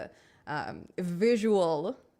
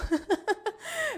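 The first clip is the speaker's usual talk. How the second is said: high giggle; 14 semitones higher than usual. Real, not acted. Laughs about the tech people.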